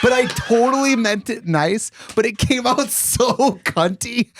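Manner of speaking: high, laughing through the words; giggling while explaining. Real, not acted.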